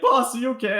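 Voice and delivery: high, anxious call to the boss; worried lackey. Acted. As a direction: check on the boss in alarm. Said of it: "he's like".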